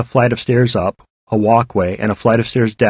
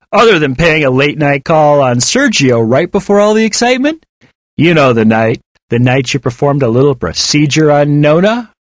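loud, raised, insinuating questions; taunting bully; 5 semitones higher, 5 dB louder than usual. Acted. David hints at Fatima's secret, loudly taunting. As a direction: needle them loudly, tune climbing at the end.

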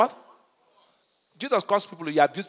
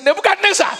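high, loud call to a king; acted defiance; 14 semitones higher, 10 dB louder than usual. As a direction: hurl the name out boldly.

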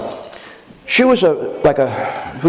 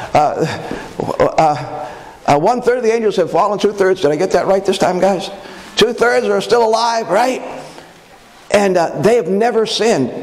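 ordinary talk, nothing marked

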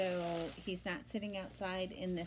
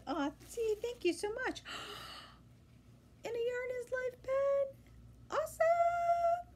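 squealing far higher, slow and delighted; voice shoots up; 4 dB louder than usual. Real, not acted. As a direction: squeal with joy over a gift. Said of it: "my voice just went up about twenty octaves".

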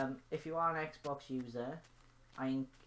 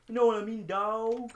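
loud slangy shout; 8 semitones higher, 12 dB louder than usual. Pretend. Repeating to viewers, finally shouting it. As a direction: shout it louder, higher, street slang.

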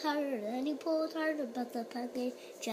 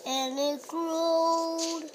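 drawn out slow and higher; slow stretched growing; 4 semitones higher, 3 dB louder, much slower than usual. Acted. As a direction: stretch the word growed long and slow. Story building repetition.

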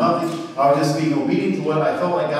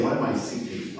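a hushed inward ask; soft unvoiced question; 3 semitones lower, 7 dB quieter than usual. Shown as a demonstration. Preacher whispering the question for the congregation to repeat. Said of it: "underline that word and say".

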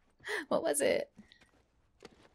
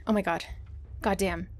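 normal talking voice

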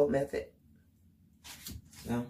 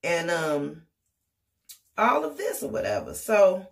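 ordinary talk

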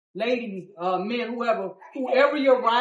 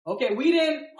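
ordinary talk

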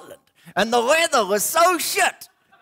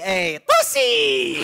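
much higher, slow puppet wolf drawl; 10 semitones higher, much slower than usual. Acted.

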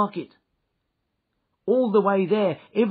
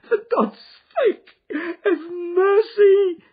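high, loud, crying plea for mercy; shrieked; 11 dB louder than usual. Acted. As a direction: beg in terror, voice breaking into sobs. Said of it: "he shrieked".

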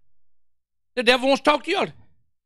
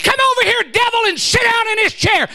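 commanding the devil; 10 semitones higher than usual. Retold or imitated. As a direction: order the devil to sit down. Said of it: "I said".

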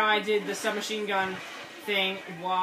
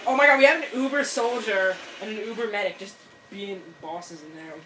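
normal talking voice

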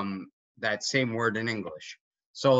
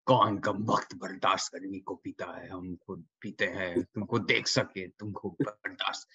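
quoted film line; 3 semitones lower than usual. Retold or imitated. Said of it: "I don't know how you do the whole".

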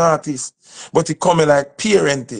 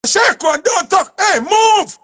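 climbing to a much higher, louder yell; aggressive shout; 4 dB louder, slower than usual. Shown as a demonstration. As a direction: bark it so the child freezes. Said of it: "You have to say".

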